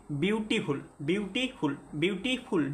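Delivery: বিউটিফুল broken before ফুল, repeated; syllable split. Shown as a demonstration. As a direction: separate বিউটি from ফুল with a small gap. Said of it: "দুইটা আছে".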